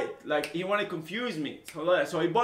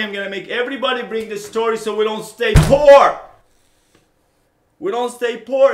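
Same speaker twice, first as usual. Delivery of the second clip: loud rallying call; 11 dB louder than usual. Real, not acted. Rallying viewers, getting loud.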